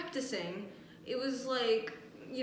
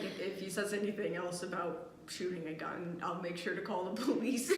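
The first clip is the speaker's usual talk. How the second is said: low, soft mock-coach voice; lightly voiced coach; 6 semitones lower, 5 dB quieter than usual. Coach reassures the kids after the threat, lightly voiced low tone. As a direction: drop the voice slightly into a calm authority.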